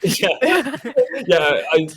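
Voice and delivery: words bubbling out through a laugh. Real, not acted. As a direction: giggle sheepishly while agreeing. Laughing at own lapse.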